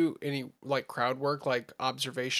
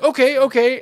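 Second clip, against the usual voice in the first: much higher and louder eager okay; hammed-up eager okay; 10 semitones higher, 12 dB louder than usual. Comedian agreeing to roast the man, eager mock okay. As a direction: jump at the chance, overplayed. Comedian gives in, retold bit.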